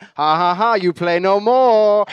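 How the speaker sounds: loud, slow, gloating mock German; bad German accent. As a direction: taunt them in a hammed-up German accent. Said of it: "I don't know what that accent was".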